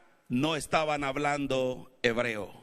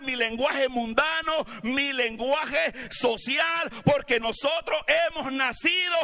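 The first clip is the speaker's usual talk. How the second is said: preaching at full force; 5 semitones higher than usual. Acted. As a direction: drive the message home to the congregation. Preacher builds again to climax.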